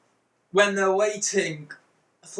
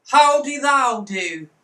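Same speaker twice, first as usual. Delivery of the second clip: loud, high, slow mock-archaic greeting; hailing peasant; 5 semitones higher, 7 dB louder, slower than usual. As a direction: hail a stranger like an olde-worlde peasant. Hailing a stranger in mock-archaic talk.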